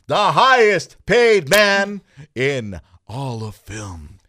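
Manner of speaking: slow, booming announcer build-up to a hush; ring announcer. Acted. Introducing Dwayne Johnson to friends like a ring announcer, slow and booming. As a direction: hype it up like a wrestling announcer, then drop.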